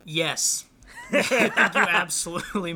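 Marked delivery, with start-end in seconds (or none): 0.9-2.7 laughing. Real, not acted. Mock solemn legal advice.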